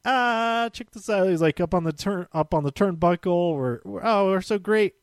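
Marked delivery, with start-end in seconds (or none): 0.0-1.3 high-pitched boastful show-off imitation; playful swagger, voice pitched up; mock showboating. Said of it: "he was busy showboating". 4.0-4.9 high, boastful imitation of a show-off; playful mock self-congratulation; mock showboating. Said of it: "he was busy showboating".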